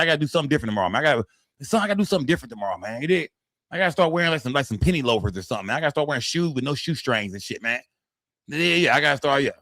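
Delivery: ordinary talk, nothing marked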